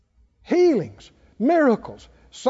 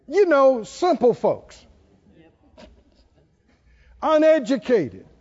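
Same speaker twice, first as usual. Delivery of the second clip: mock superior sneer.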